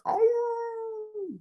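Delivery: big wolf howl, different timbre. Shown as a demonstration. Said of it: "daddy or mama wolf".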